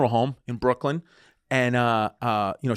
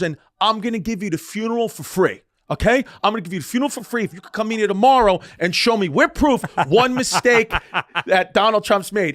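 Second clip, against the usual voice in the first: high, emphatic mock wager; heated dare; 6 semitones higher than usual. Funeral director daring mourners with a free funeral, heated. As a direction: imitate a worked-up man throwing down a challenge.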